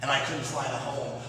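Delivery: ordinary delivery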